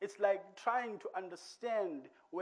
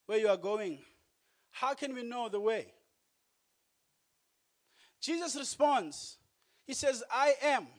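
higher and louder preacher cadence; preaching; 5 semitones higher, 7 dB louder than usual. Acted. Preacher retelling Thomas and Jesus to the church, raised. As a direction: lift it up, proclaiming.

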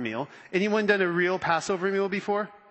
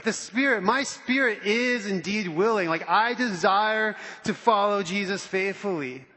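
usual speaking voice

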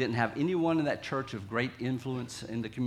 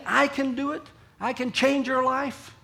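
high, insistent, rising boast; boastful self-confidence; 10 semitones higher, 3 dB louder than usual. Acted. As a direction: proclaim your own ability, pitched up. Voicing self-confident strong people.

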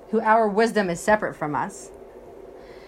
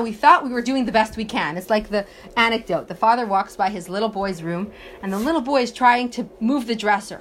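ordinary delivery

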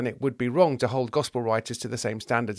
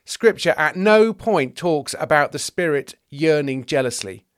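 slow, loud, raised objection; emphatic correction; 4 semitones higher, 5 dB louder, slower than usual. Acted. Teacher of the law disputes James's scripture, emphatic. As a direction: spell out the objection with weighty emphasis.

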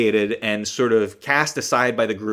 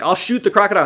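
higher, louder, keen offer; eager deputy; 8 semitones higher, 5 dB louder, faster than usual. Retold or imitated. Deputy offering to shoot the croc, higher and louder. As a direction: pitch it up like a trigger-happy deputy. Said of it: "Deputy Gare is like".

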